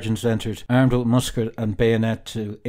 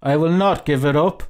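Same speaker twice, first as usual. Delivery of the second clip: higher, firm refusal rising at the end; defiant; 5 semitones higher, 3 dB louder than usual. Acted. Traveller refusing to hand the captain his papers, defiant. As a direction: dig in, voice raised in pitch.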